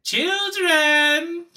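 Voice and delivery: high, loud villain call; child catcher. Retold or imitated. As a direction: lure the kids with a sing-song cry.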